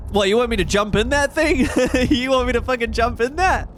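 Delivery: loud, disbelieving, half laughing; you can't be serious, said loudly; incredulous. Real, not acted. Disbelief at game prompt.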